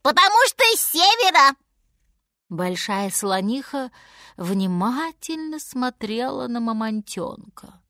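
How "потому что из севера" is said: high, loud, rapid monkey squeal; little monkey. A narrator's reading. Monkey adds explanation.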